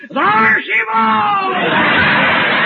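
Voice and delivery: long, high drawn-out cry; mock lookout yell. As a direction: yell it like a whaler's lookout, stretched out. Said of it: "I get up yelling".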